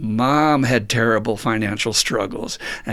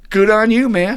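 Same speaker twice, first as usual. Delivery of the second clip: cheering someone on; 10 semitones higher, 5 dB louder than usual. Retold or imitated. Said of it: "And you're like".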